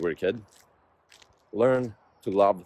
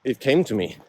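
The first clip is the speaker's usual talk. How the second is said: raised and louder, pushing words out between strides; talking mid-run; 7 semitones higher, 7 dB louder than usual. Real, not acted. Vlogger sharing thoughts to camera while running, breathless and loud. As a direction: speak while jogging, breath working hard.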